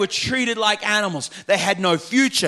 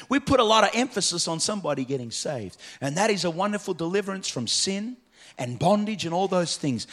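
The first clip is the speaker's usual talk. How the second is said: ordinary delivery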